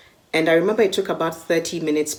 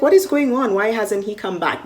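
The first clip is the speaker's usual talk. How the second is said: alarmed disbelief; 7 semitones higher, 3 dB louder than usual. Retold or imitated. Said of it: "And I'm like".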